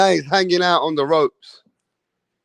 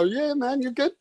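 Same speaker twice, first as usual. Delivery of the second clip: coaching referee; 8 semitones higher than usual. Retold or imitated. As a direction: gently reassure him like a coach. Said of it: "He's letting him know".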